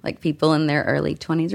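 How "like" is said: usual speaking voice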